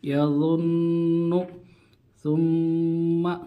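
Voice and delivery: यज़ुन्नु and सुम्मा slow, the doubled न and म held in the nose; slow teacher's ghunna on यज़ुन्नु, सुम्मा; nasal ghunna. Shown as a demonstration. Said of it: "एक अलिफ के बराबर गुन्ना करके ही आगे पढ़ना है".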